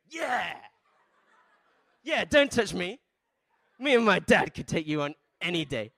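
loud, high mock-boastful boy; hammed-up bravado, shouted high; cocky brave kid. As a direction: imitate a kid crowing behind his dad. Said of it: "the strongest, most brave".

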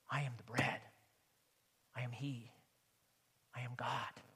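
preacher whispering Jesus' words; hushed Jesus. Acted. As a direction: breathe it out low and hushed. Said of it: "Jesus is saying".

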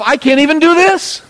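high, loud, fed-up outburst; exasperated self-disgust. Retold or imitated. Peter berating himself after a fishless night, exasperated. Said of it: "Peter must have been thinking to himself".

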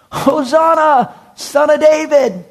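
put-on high, loud and slow child's cry; little children shouting. Preacher voicing little children crying out in the temple, high loud slow shout. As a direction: lift it way up like a small child shouting praise.

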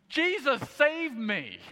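high, loud whiny cry for help; big man crying like a baby; babyish cry. Retold or imitated. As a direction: wail it like a little baby. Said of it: "crying out like, like a little baby".

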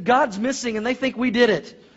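high, loud, alarmed punchline; panicked older brother. Acted.

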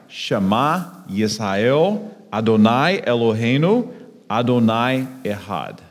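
Hebrew liturgical pronunciation. Shown as a demonstration. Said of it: "in Hebrew it would be something like".

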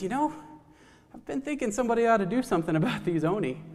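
high, fast bird voice; squawky pheasant. Acted. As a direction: start up high and rush it like a flustered bird. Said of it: "And the pheasant says".

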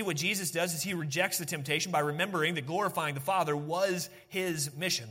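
normal talking voice

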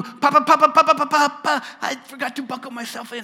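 put-on high, loud papa calls like a small child; childlike call to God. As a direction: cry to God like a toddler. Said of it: "we can tell him".

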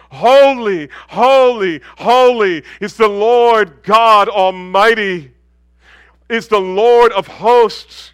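loud, slow, ringing cry of holy; proclaiming seraph. Acted. Seraph calling to the other seraph, a loud slow proclamation. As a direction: call it across heaven like a seraph.

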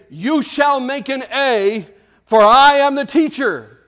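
loud, high, slow demanding decree; stern commanding teacher. Shown as a demonstration. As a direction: bark it down at the class like a tyrant. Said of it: "but he says it like this".

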